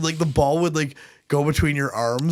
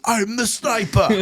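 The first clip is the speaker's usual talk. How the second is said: mock action hero; 8 semitones higher, slower than usual. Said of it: "he was like".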